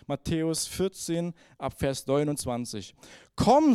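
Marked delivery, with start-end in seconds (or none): 3.4-3.7 loud, higher single word call; inviting call. A narrator's reading. Jesus calls Peter onto the water, loud inviting call. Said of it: "sagte Jesus".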